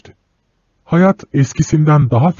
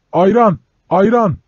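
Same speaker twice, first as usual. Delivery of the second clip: shouting at full voice; 8 semitones higher than usual. Acted. Frightened boy shouting to passengers, at the top of his voice. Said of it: "avazı çıktığı kadar bağırdı".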